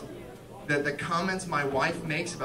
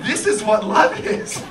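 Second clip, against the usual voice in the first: slow, higher, louder joyful declaration; elated realization; 4 semitones higher, 6 dB louder than usual. Acted. Husband suddenly understanding love, slow elated declaration. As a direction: declare it with growing wonder. Answers himself, delighted realization.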